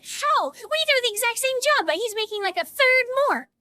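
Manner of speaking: high-pitched indignant protest; hammed-up inner outrage; mock outraged disbelief. Silently outraged at coworker's higher pay.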